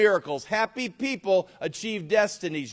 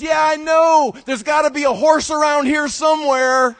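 loud, raised, eager shout; gleeful shout; 9 semitones higher, 10 dB louder than usual. Acted. Optimist son answering his dad with an excited shout. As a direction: shout it out full of hope. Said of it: "He says".

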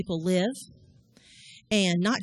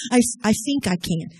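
louder, imitating a little boy's speech impediment; a small boy stammering bravely; mild imitation of a lisping four-year-old; child with lisp; 6 dB louder than usual. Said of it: "He had a little speech impediment".

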